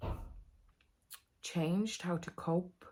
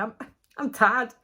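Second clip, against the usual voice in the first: attempted Welsh accent, louder and higher; 5 semitones higher, 8 dB louder than usual. Shown as a demonstration. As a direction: have a shaky go at a Welsh accent. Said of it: "maybe a Welsh accent".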